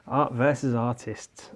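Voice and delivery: normal talking voice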